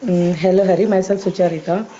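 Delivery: ordinary talk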